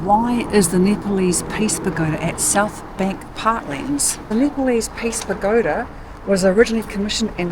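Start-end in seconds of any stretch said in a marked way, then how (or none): none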